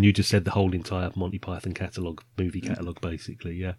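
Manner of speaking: usual speaking voice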